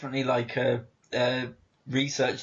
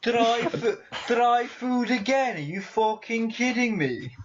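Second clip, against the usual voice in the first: high, mock-outraged Brummie whine; Brummie dog; 9 semitones higher than usual. Dog objecting to its dinner, high mock Brummie. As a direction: protest in a hammed-up Brummie accent. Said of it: "just imagine a Brummie going".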